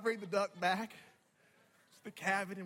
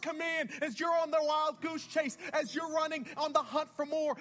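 raised, rhythmic sermon cadence; building, driving preaching; 7 semitones higher than usual. Real, not acted. Preacher voicing God's call to look up, driving preaching. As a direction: pile up the phrases, then land the command.

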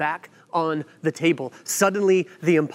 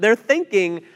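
usual speaking voice

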